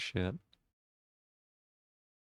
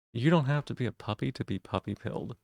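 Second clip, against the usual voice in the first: ordinary talk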